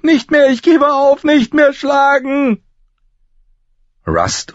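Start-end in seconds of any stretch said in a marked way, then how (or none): 0.0-2.6 very high, loud, desperate squeal; panicked shrieking. A narrator's reading. Beaten boy begs not to be hit, shrieking. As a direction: beg for mercy in a shriek. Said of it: "kreischte er".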